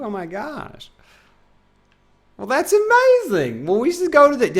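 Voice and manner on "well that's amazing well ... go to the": mock excitement.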